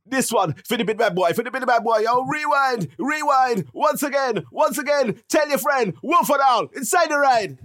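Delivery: high, chanted MC shout-outs; hype MC. Pretend. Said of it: "that was a weird energy".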